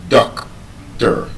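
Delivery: "doctor" broken up, DOC then a lighter tor; stress on first syllable. Shown as a demonstration. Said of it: "the stress is on the first syllable".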